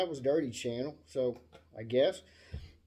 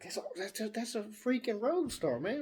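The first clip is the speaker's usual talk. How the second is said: retold amazement; 6 semitones higher than usual. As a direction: marvel at an incredible find. Retelling his amazed find.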